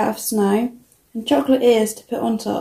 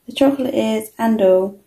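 plain reading voice

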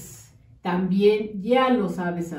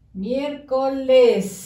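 Miércoles slow, in separate syllables, Miér-co-les; slow teacher's sound-out of Miércoles, syllable by syllable; 3 dB louder, slower than usual. Shown as a demonstration. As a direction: stretch each syllable of Miércoles and hold the final s. Sounds out word while writing it.

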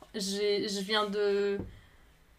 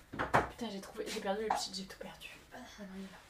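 chuchoté pour soi, sans voix; 6 semitones lower, 11 dB quieter, faster than usual. Real, not acted. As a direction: marmonne tout bas en cherchant son outil. Searching for lost tool. (chuchotement agacé pour soi-même)

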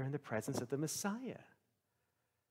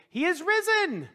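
high, loud joyful cry; joyful shout; 11 dB louder than usual. Retold or imitated. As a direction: shout the good news, much higher. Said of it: "she tells all the others".

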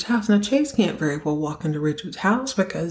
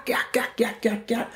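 mock back-slap grunts; 4 semitones higher, 4 dB quieter than usual. Imitating hugging noises.

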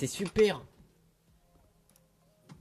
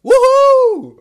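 whoop; 11 dB louder than usual. Acted. Cheering whoop.

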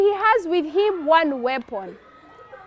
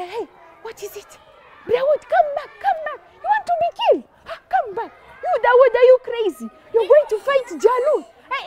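worried crowd; 5 semitones higher than usual. Acted. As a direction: call a boy back in disbelief. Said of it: "the people are saying".

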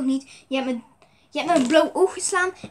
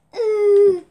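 pained cry; 5 semitones higher, 10 dB louder than usual. Acted. Hurt figure cries out.

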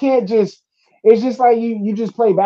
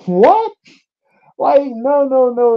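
outraged disbelief; 6 semitones higher, slower than usual. Real, not acted.

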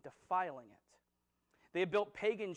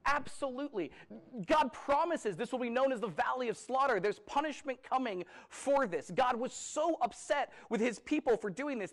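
louder, higher fervent sermon delivery; preaching at full force; 7 semitones higher, 6 dB louder than usual. Acted. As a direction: drive the point home from the pulpit. Preaching hell is real.

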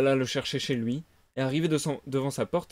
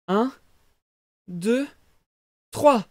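rising count ending in a shout; counting aloud; 8 semitones higher, 6 dB louder, much slower than usual. Acted. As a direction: count building to a loud three. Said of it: "compter à voix haute". (compter à voix haute, crescendo)

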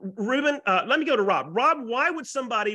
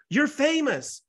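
high-pitched amazed exclamation; awed quote; 9 semitones higher, much slower than usual. Retold or imitated. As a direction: imitate the awestruck client. Retelling client's awed remark.

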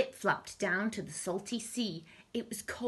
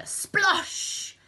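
loud, high "splosh!"; explosive sound-effect word; splashing noise; 14 semitones higher, 6 dB louder than usual. A narrator's reading. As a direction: burst it out like a splash. Narrator voices the splash.

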